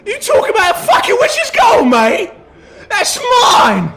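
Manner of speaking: very high, loud yelled claim, laughing; rowdy rat folk shout. Acted. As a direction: bellow like a scrappy cockney thug. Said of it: "one goes".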